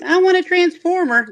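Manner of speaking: high-pitched child gamer imitation; squeaky kid voice. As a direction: mimic a little kid squeaking the line back. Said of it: "if a squeaker responded with".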